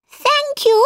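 very high mouse thanks; squeaky mouse. A narrator's reading. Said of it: "His voice was high and squeaky".

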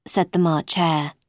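usual speaking voice

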